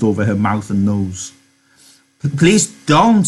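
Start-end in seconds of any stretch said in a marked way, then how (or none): 2.2-3.3 higher, stammered, drawn-out plea; begging. Acted. Lenny panicking over the struggling woman, begging. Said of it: "he begged".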